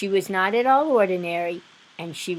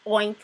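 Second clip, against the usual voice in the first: piglet oink; 3 semitones higher, 4 dB louder than usual. Acted. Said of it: "said the piglet".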